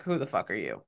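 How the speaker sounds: put-on low, quiet German-accented growl. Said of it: "Like, in a German accent".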